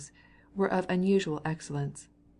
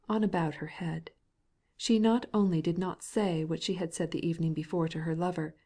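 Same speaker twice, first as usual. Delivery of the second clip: ordinary delivery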